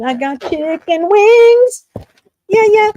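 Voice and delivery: high, loud and drawn out; thrilled, stretching each word; excited. Real, not acted. Food arrives.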